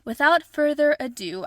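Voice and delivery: host restarts the phrase with a flourish; bold restart. Shown as a demonstration. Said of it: "I think that's the correct word".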